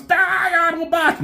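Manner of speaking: high shouted war cry; battle cry. Retold or imitated. Megatron charging the Autobots, high shouted war cry. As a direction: scream the attack order. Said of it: "And Megatron's".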